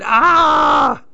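very high, frightened scream; scared scream. Acted. Lil imagining closet monsters, scared scream. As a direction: shriek at the monsters.

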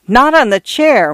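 high-pitched alarmed protest; crying out. A narrator's reading. Housekeeper tries to stop the priest striking a match, crying out. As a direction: call out a sudden warning. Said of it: "Missus Stoner cried".

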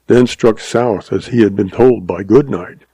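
ordinary delivery, nothing marked

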